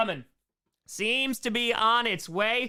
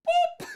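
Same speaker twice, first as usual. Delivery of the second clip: squeaky high boop, a bit louder; 3 dB louder than usual. Acted. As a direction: squeak it like a toy. Donation boop sound effect.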